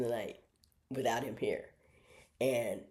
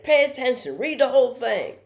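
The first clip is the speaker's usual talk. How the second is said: loud, high classroom order; mean math teacher; 11 semitones higher, 11 dB louder than usual. Retold or imitated. Math teacher scolding his class, mean and loud. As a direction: act out a strict teacher raising the voice. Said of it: "the meanest".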